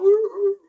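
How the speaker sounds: high pained dog howl; loud yelping woo, dog in pain; dog hollering. Retold or imitated. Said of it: "the dog would just be hollering out".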